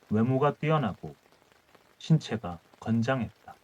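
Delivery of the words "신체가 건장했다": plain reading voice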